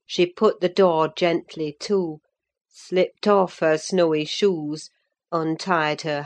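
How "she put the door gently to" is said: ordinary delivery, nothing marked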